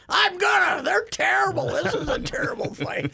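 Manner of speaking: high, loud retold complaint; imitated exasperated shouting; mock indignant outburst. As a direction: rail at the fighters like a fed-up spectator. Acts out man railing at fighters.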